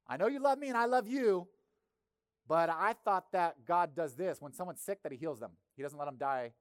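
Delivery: skeptical sister. Retold or imitated. Martha questioning Jesus about her dead brother, hurt and puzzled. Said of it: "were a little bit skeptical".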